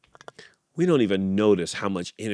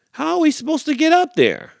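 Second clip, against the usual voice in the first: very high puzzled squeak; high worried critter; 3 dB louder than usual. Acted. Animal asks high.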